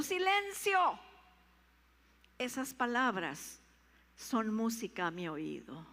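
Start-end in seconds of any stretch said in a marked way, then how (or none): 0.0-1.0 higher, louder hushing command; God the Father. Pretend. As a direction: call for silence from heaven. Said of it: "Dios Padre diciendo". (Dios Padre pidiendo silencio, fuerte)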